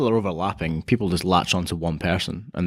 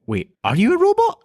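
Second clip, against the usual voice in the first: startled realization; 3 dB louder than usual. Retold or imitated. Telemarketer call turns out robotic.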